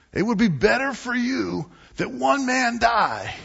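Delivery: high, loud shout; shouted verdict. Acted. High priest proclaiming to the council, a booming shout. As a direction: bellow the decision over the room.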